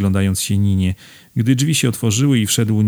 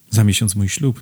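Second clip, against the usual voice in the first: plain reading voice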